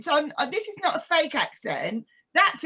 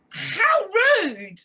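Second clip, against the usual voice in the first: high, drawn-out mock outrage; indignant; 8 semitones higher, 5 dB louder than usual. Taken for a senior by a stranger, high-pitched indignation. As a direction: exclaim it as if deeply insulted.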